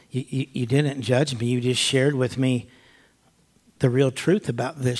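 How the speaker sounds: hushed imitation of a moved woman; tearful woman, retold. Crying woman thanking him for not judging, softly retold.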